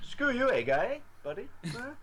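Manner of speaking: high, loud cartoon Canadian jab; mock Canadian. Trading South Park quotes with Burhan in a mock Canadian accent. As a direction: fire back in a hammed-up Canadian accent.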